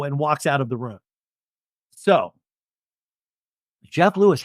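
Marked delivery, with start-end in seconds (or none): none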